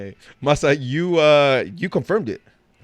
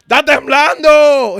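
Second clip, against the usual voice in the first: much higher, louder, slow drawn-out yell in Spanish; mom yelling; 12 semitones higher, 9 dB louder, much slower than usual. Retold or imitated. Mom shouting to the house that it's shaking, slow loud yell. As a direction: call it out like a mom raising the alarm. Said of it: "you hear Mom".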